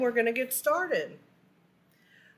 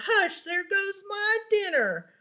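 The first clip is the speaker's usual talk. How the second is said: Gus yells; 10 semitones higher than usual. Acted. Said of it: "Gus yelled".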